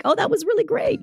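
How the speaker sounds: high-pitched fake gushing; pretend enthusiasm. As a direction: feign being impressed, way up high. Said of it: "have to pretend and be like".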